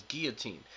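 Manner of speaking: put-on "guillotine" as GEE-uh-teen, softly; soft "guillotine" with no L sound; pronounced without L. Shown as a demonstration. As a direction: leave the L silent in "guillotine". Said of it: "how I was raised to pronounce".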